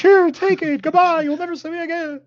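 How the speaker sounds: very high-pitched squeaky getaway voice; fleeing captive. Acted. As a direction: call it out high and hurried while fleeing. Acting fleeing captive tossing staff back.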